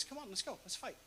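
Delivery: taunting. Retold or imitated. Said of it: "taunts them and says".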